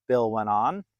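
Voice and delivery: plain reading voice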